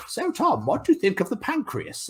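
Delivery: high, prim teacher voice; mock old teacher. Biology teacher asking young Tom a question, mock prim and high. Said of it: "She came over and she was like".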